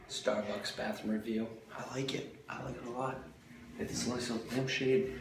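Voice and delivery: quiet bathroom review; speaking softly in a restroom; hushed voice. Real, not acted.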